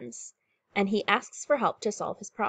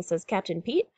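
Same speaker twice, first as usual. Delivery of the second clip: usual speaking voice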